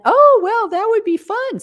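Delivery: high, loud burst of delight; eager. Retold or imitated. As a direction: gush like you've found a treat. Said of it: "And I went".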